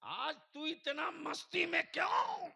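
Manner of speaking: high, loud call to the Kaaba; impassioned preaching. Acted. Acts out addressing the Kaaba in preaching. (जोशीली तक़रीर में ऊँची पुकार)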